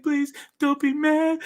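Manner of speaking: high, slow mock-crying voice; imitating tearful pleading. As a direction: plead like a mom in tears, hammed up. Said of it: "Literally crying".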